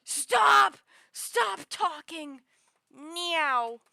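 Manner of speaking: loud high mock yell, slowing down; imitating annoyed shout. Viewers yelling at the streamer to stop. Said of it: "everyone would be like".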